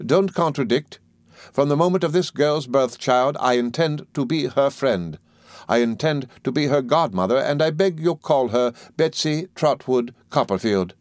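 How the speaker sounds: high-pitched, emphatic aunt; insistent aunt. A narrator's reading. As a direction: declare it with an aunt's certainty. Voices Miss Betsy.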